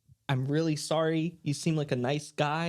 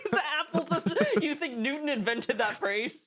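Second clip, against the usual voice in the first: high, giggling through the question; laughing while asking; 11 semitones higher than usual. Real, not acted. Cracking up at the joke.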